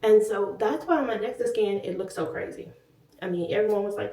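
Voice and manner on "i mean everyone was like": ordinary delivery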